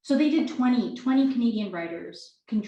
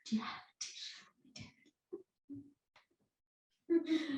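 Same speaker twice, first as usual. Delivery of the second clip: whispered through tears, nearly unintelligible; sobbed request; 7 semitones higher, 15 dB quieter, much faster than usual. Acted. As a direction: barely get the words out through sobbing. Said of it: "The crying is so extreme that some of the following line becomes unintelligible".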